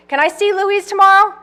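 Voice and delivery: high, loud call from off; calling from offstage. Acted. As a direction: call it back over the shoulder, loud.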